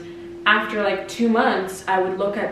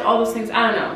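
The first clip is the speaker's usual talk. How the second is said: ordinary talk, nothing marked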